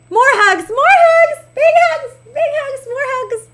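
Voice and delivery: high squeals, loud at first then easing; gushing affection. Real, not acted. Streamer showering a viewer with hugs, gushing high squeals. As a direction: squeal out each hug in a very high voice.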